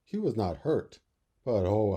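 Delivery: ordinary delivery, nothing marked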